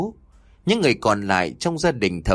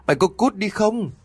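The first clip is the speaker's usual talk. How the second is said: sharply raised pitch, angry ultimatum; final furious warning to get out; escalated resolute dismissal; 10 semitones higher than usual. A narrator's reading. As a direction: repeat the order, much higher. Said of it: "giọng của bà vẫn kiên quyết lắm".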